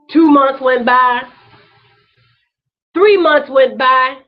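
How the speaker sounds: slow pounding count of the months; slow emphatic counting. Real, not acted. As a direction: lean on "Two" and "Three" one by one.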